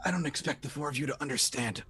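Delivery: soft, weary voice of the badly hurt; wounded man. Dying man doubts the four will understand, quietly. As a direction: say it quietly, short of strength. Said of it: "He's like".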